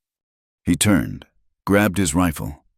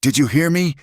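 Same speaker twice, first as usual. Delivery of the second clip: higher, angry yell at her; 11 semitones higher than usual. A narrator's reading. Rancher yelling at Clara to leave, hoarse angry yell. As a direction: bark it across the yard. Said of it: "he yelled".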